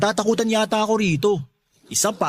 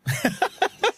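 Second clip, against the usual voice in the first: teasing laugh. Acted. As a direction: laugh it off at her scolding. Teasing laugh after rebuff. (mapanuksong tawa)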